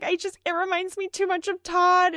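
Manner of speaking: choked-up voice remembering her own puppy; tearful. Retold or imitated. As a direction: retell it on the edge of tears. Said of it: "starting to tear up".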